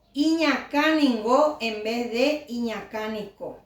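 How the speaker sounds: Iñacáningo ending in nasal ngo, not iñacánico. Shown as a demonstration.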